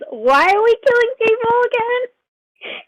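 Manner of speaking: high, drawn-out disbelief, climbing in pitch; incredulous question. Acted. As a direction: stretch it out as an appalled question. Acted disbelief at murder plan.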